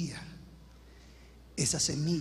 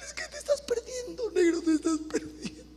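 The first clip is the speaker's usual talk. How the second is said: crying mother; 13 semitones higher than usual. Retold or imitated. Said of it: "a llorar como una Magdalena".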